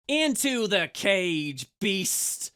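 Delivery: slow, commanding shout. A narrator's reading. Asura orders the Char into the cage, shouting. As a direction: bark the order at the beast. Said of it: "shouted the Asura".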